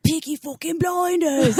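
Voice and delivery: put-on slow, high-pitched British gangster accent; Tom Hardy impression. Retelling greeting a British stranger, hammed-up British accent. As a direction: draw it out in a hammed-up Peaky Blinders British accent. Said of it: "You sound just like Tom Hardy".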